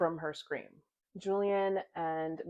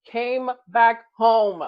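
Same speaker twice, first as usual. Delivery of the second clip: slow, much louder, higher twist announcement; excited reveal; 4 semitones higher, 12 dB louder, slower than usual. Real, not acted. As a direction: drop the bombshell with real excitement.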